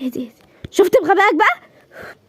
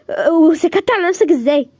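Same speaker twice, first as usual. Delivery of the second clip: ordinary talk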